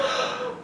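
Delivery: sharp high gasp; a sudden excited intake of breath; mock gasp. Female friends craving babies, sharp high gasp.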